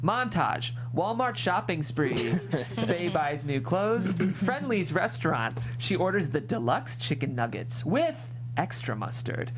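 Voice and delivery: usual speaking voice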